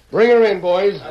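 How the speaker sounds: high, loud call to the henchmen. Acted. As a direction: call it off-stage to his men. Villain calling to his henchmen.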